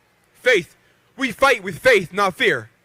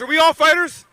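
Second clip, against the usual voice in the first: pitched up and louder, calling out to the crowd; rally call to the crowd; 7 semitones higher, 5 dB louder than usual. Real, not acted. Boxer firing up his hometown crowd, loud rallying call. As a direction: rouse the crowd, shout it back to them. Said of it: "I can't hear you guys".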